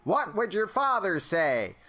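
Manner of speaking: high, slow mannered reproach; David Lynch. Retold or imitated. Player as Alfred shaming Batman, David Lynch voice. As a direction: draw out the rebuke as an impersonation. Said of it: "get David Lynch to be Alfred".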